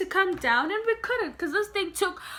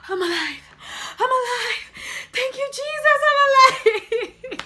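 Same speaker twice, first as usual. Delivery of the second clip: higher, laughing cry of relief; relieved friend; 10 semitones higher, 3 dB louder than usual. Retold or imitated. Friend stepping off the ride, laughing, high relief. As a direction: gush with giddy relief after the ride. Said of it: "he was like".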